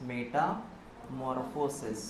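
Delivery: Metamorphosis slowly, broken into two parts; slow dictation. Shown as a demonstration. Teacher repeating a new term for the class, slow and segmented. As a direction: spell out Metamorphosis by ear, pausing after Meta.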